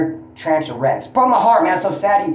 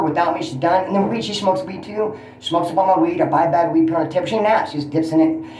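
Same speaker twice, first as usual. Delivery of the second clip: ordinary delivery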